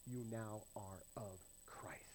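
slow whispered declaration. Real, not acted. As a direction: breathe it out as a whisper. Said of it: "whispered conclusion".

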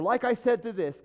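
mock someone forcing their point in; butting in. Acts out people butting in.

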